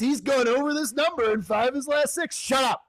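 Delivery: high-pitched, hammed-up betting tout. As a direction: hype the pick like a mock announcer. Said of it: "that's the tout voice".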